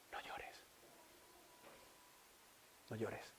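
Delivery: breathy whisper, barely there. Acted. Jesus whispering to the grieving widow. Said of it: "te susurrara".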